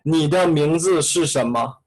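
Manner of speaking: ordinary delivery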